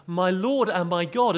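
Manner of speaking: loud, high exclamation "My Lord and my God," flung upward; as if swearing. Retold or imitated. The Witness's version of Thomas swearing, loud exclamation. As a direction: blurt "My Lord and my God" like an oath. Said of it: "as if he was swearing".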